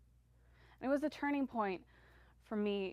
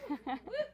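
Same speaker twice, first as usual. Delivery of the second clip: bright high laugh; 11 semitones higher than usual. Real, not acted. Laugh after audience yes.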